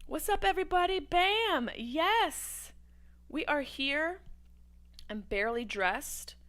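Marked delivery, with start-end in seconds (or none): 0.1-4.2 hyped greeting. Real, not acted. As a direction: greet the crowd with a bang.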